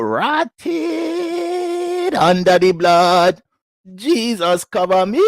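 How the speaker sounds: put-on loud, drawn-out Jamaican accent; mock Jamaican exclamation. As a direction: cry out high and slow in Jamaican accent.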